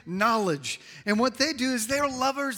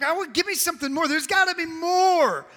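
high, loud mock pleading; exasperated demand; 9 semitones higher, 4 dB louder than usual. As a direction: act out a dissatisfied listener demanding more. Acting listeners demanding something more.

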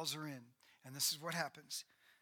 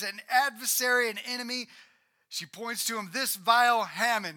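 accusing Esther; 4 semitones higher, 9 dB louder than usual. Acted. Esther accuses Haman before king.

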